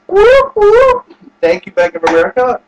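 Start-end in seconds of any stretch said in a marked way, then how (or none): 0.1-1.1 high-pitched double whoop; two high, gleeful whoops; celebratory whoop. Real, not acted. Borrower dancing over an approved loan, whooping high.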